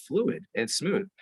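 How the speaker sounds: slow, smooth, flowing delivery; smooth and fluid. Shown as a demonstration. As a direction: let the words glide out slowly. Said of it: "It should sound, like".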